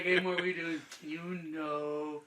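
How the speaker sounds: sarcastic. Retold or imitated. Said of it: "It's always sarcastic".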